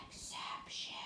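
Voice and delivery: hushed, unvoiced whisper; playful whisper. Shown as a demonstration. Teacher naming the spelling rule to pupils, whispered. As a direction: whisper it like a secret keyword.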